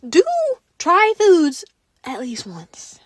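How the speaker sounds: slow, breathy whisper; confiding a tip to viewers in a slow whisper; whispered advice. Real, not acted. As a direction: lean in and whisper it slowly. Advice given with emphasis.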